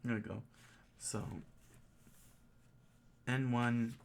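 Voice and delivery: normal talking voice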